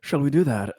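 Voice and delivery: ordinary talk, nothing marked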